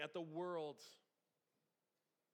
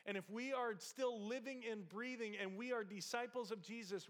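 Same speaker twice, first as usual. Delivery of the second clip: usual speaking voice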